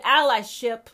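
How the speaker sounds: quoting mama. Retold or imitated. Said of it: "She said".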